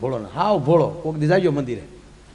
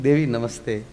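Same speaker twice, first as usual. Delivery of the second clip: soft greeting; 7 semitones lower, 6 dB quieter than usual. Retold or imitated. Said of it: "ભગવાન શિવે કીધું".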